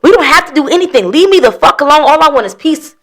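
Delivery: high, loud fed-up protest; exasperated outburst. Retold or imitated. Ex answering a backhanded apology, exasperated outburst.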